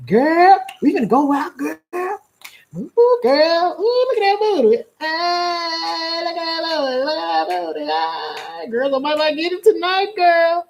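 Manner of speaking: high-pitched, squealing mock girlfriend hype; girls hyped for night out. Girls getting together before the club, high excited squeals. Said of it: "The girls get together".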